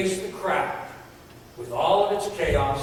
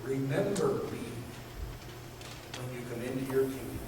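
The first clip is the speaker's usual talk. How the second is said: soft, humble request; quiet plea; 4 semitones lower, 9 dB quieter, faster than usual. Retold or imitated. The thief pleading quietly with Jesus. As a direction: ask it gently, almost under your breath.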